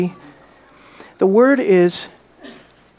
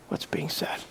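trailing into a breathy whisper; 17 dB quieter than usual. Acted. Preacher quoting Jesus to his church, whispering. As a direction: let the last words fall to a whisper.

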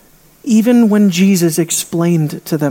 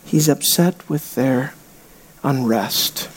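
much lower and softer, slow; hushed; 8 semitones lower, 6 dB quieter, slower than usual. Real, not acted. Preacher quietly explaining Jesus' displeasure, much softer. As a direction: let it sink to a near hush. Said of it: "drops voice".

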